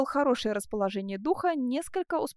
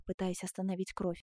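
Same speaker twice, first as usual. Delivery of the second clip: ordinary delivery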